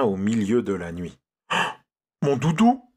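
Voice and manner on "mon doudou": high, loud alarmed cry; frightened boy crying out; panicked cry. A narrator's reading. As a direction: call out in sudden panic. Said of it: "s'affola-t-il".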